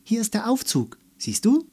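put-on little girl showing her friend the elevator; excited showing. A narrator's reading. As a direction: point it out with delight. Melissa showing her game.

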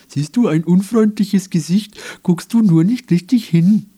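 high, loud, sing-song mimicry; mocking imitation of Clarence. Grumpy unicorn mocking Clarence, high and loud. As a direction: ape Clarence in a shrill put-on voice. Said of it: "äffte er Clarence nach".

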